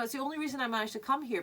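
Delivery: normal talking voice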